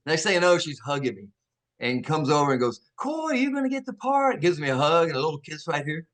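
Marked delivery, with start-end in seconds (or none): 2.9-4.4 high-pitched retold congratulations; lightly mimicked gushing woman; imitated excited girl. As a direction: lift it high as an encouraging young woman. Voices the girl congratulating him.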